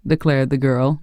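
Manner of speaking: ordinary delivery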